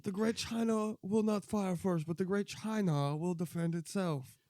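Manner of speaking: put-on slow, high-pitched Chinese-accent caricature; mock Chinese accent. Playing a Chinese leader warning the world, mock Chinese accent. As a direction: declaim it slowly in hammed-up Chinese-accented English. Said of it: "Your English is broken".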